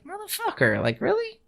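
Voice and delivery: high-pitched mock disbelief; exasperated high-voiced retelling; incredulous retort. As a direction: jump way up in pitch, can't believe it. Incredulous retort to unfair callers.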